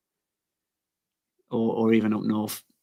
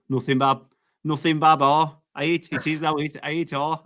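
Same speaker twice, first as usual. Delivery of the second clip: higher, louder put-on Brummie; Birmingham accent; 5 semitones higher, 3 dB louder than usual. Guest showing how they speak in Birmingham, Brummie accent. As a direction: imitate a Birmingham local saying "Bab". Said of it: "that's a Birmingham thing for babe".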